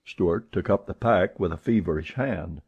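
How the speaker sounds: plain reading voice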